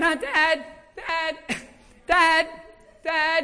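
high, loud teasing calls with a giggle; joking kids calling. Retold or imitated. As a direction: call dad playfully like a laughing child. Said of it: "they're joking around".

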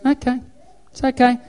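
high, mock-soothing sat-nav; forgiving GPS. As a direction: reassure in a high little GPS voice. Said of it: "It just goes".

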